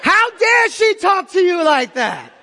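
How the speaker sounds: slow, high-pitched indignant shout; hammed-up outrage, drawn out at full voice; going in angry. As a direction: rage theatrically on someone's behalf. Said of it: "I just start going in".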